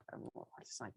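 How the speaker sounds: breathy whisper of a customer making up her mind; whispered aside. Retold or imitated. Salesman voicing Laura deciding, whispered. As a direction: whisper it, hesitating.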